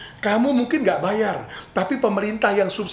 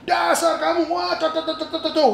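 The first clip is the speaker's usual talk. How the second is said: mock angry car owner; 9 semitones higher than usual. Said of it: "keluar ngamuk-ngamuk".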